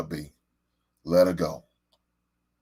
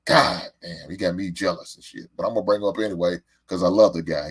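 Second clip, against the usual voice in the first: usual speaking voice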